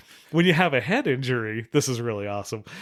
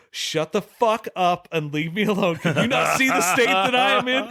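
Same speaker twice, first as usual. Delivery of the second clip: hammed-up snap, climbing much higher toward the end; mock angry outburst; 7 semitones higher than usual. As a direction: rant at the bishop, getting shriller. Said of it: "and I said".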